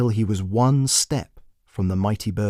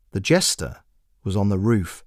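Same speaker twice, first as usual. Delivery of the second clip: ordinary delivery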